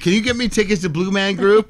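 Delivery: high, loud begging for tickets; pushy friend. Retold or imitated. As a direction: mimic a friend pestering for favours. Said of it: "They're gonna be like".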